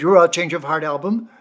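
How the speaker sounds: put-on loud, eager Russian-accented question; the Russian fan checking excitedly, thick accent. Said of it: "thick Russian accent".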